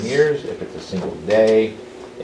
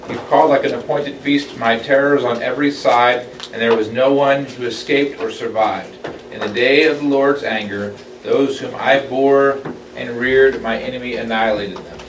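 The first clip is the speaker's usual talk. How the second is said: raised, louder solemn reading voice; scripture reading; 3 semitones higher, 4 dB louder than usual. Acted. Teacher reading Isaiah aloud to the Bible study, solemn. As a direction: read it out as holy text.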